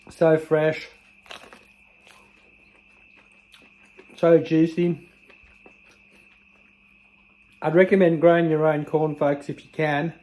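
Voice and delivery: talking while chewing; mouth full. Real, not acted. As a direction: speak through a mouthful of corn. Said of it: "Sorry for eating with my mouth full".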